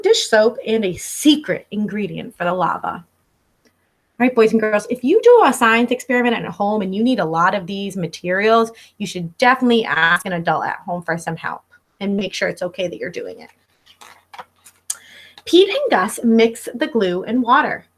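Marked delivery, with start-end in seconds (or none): none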